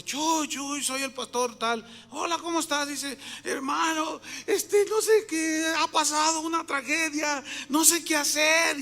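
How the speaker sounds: high-pitched mock crying on the phone; imitated tearful distress. Distraught pastor phoning about a tragedy, imitated crying. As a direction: act out a distraught caller, drawing words out. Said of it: "estaba llorando".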